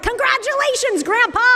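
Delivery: high, bright-eyed congratulating shout; excited cheer. Retold or imitated. Bagel store staff cheering the father, high and excited. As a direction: greet the new grandpa with a squeal of joy. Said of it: "and they're like".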